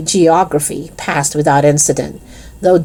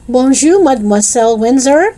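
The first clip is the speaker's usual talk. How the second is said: high, sing-out pupils' greeting; chorus it like a class greeting teacher; raised-pitch classroom chorus in French; class in unison; 7 semitones higher, 3 dB louder than usual. A narrator's reading. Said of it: "said the class in unison".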